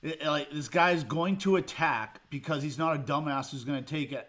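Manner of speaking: normal talking voice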